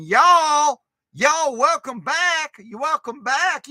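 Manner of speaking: high, slow hammed-up Southern drawl. Streamer greets his chat in a mock Southern drawl. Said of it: "a little bit of a Southern drawl".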